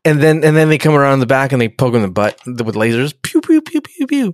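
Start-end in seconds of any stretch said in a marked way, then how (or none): 3.2-4.3 high-pitched pew pew blasts; cartoon laser noises, pitched way up. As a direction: spit out little laser shots up high. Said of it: "laser sound effect".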